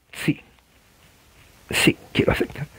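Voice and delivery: faint, whispery mock-tearful yes; small, breath-only consent; overcome convert. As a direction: imitate a weepy convert giving in softly. Acting overcome convert answering preacher.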